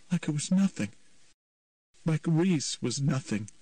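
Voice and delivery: put-on distraught teen voice, pleading; anguished young man. A narrator's reading. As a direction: confront his mother, hurt and bitter. Patrick's confrontation with his mother.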